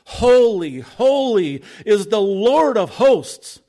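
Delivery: loud, slow, ringing proclamation; seraphim calling out. Acted. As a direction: call it out like angels crying to one another. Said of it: "they were calling out to one another".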